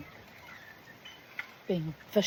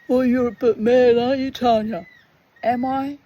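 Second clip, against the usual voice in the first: high, much louder sneering taunt; mocking Steph voice; 6 semitones higher, 10 dB louder than usual. Steph teasing Tanya that she's mad, loud mocking voice. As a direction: toss it off as a smug jeer, pitched up. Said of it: "Steph's reply".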